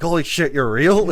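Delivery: loud, high mock shock breaking into laughter; laughing, hammed-up astonishment; shocked disbelief. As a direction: gasp it out as if seeing a ghost. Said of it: "It's like".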